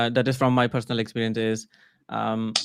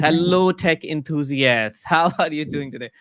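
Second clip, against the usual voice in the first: over-enthusiastic AI greeting; 3 semitones higher than usual. Retold or imitated. AI greeting a Discord community, hammed-up enthusiasm. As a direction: imitate an AI's overeager hello, stretching the words. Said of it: "like this is coming from the A I".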